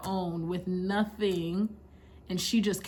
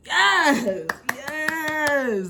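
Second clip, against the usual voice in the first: delighted yes; 6 semitones higher, 6 dB louder than usual. Real, not acted. Delighted at her own point.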